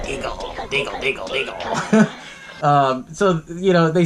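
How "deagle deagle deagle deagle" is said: soft, slow creature chanting a name; imitated gremlin voice. Retelling the gremlin reading Missus Deagle's sign, creature voice. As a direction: lightly croon it like a little monster. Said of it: "He looks at it".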